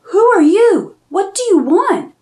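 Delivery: put-on high, loud startled cry of a scared girl; jolted awake and scared, calling out; frightened girl. Girl woken by a witch, frightened and loud. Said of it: "she asked".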